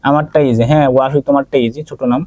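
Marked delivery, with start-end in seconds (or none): none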